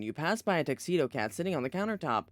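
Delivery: ordinary delivery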